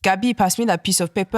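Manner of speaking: put-on thick French-accented English. Imitating French dad asking for paper, thick French accent. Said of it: "that's my dad's accent".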